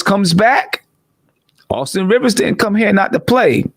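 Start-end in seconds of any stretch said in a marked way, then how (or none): none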